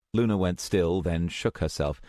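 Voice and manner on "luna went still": usual speaking voice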